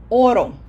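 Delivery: "oro" with a strong first syllable, falling end; stress on first O. Shown as a demonstration. Teacher showing the word without its accent mark, first-syllable stress on "oro". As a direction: hit the opening o of "oro". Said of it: "Without the accent mark, it sounds".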